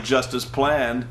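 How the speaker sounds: low, slow smug line; smug mock calm. Avatar after the fight, lightly smug mock calm. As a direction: deliver it like a smug mastermind. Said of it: "the Avatar is like".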